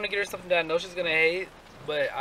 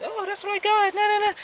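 put-on much higher, teasing kid sister. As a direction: mimic a bratty little sister. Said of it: "she doesn't watch it and be like".

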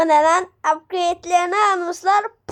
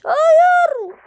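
a high, loud cry of no; shocked no; 11 semitones higher, 5 dB louder than usual. Real, not acted. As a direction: yelp it out in sudden shock. Sudden setback in the game. (yüksek ve yüksek sesle şaşkın bir hayır)

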